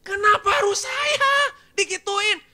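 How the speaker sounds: loud, high, slow aggrieved cry; hurt new employee protesting; indignant complaint. Retold or imitated. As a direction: wail it like being wronged.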